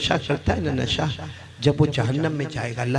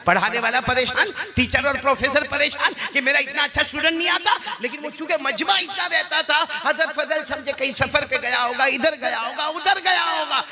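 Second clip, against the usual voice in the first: high-pitched, driving sermon delivery; impassioned preaching; 9 semitones higher than usual. Acted. Preacher telling the congregation of a worried teacher, impassioned. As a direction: preach it high, climbing as the list grows. Preacher building at full force.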